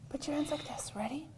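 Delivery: hushed whisper to the class. Real, not acted. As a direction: whisper to calm the room.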